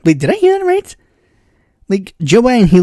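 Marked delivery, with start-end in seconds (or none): 0.0-0.9 high, squeaky double take; startled disbelief. Retold or imitated. Half-listening viewer doubting what was heard, startled. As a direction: re-enact a baffled double take. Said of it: "I was literally just like".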